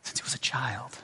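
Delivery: whispered, the words barely breathed; soft whispered echo. Shown as a demonstration. Preacher echoes the father's grief, in a whisper. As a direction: let it fall to a hush.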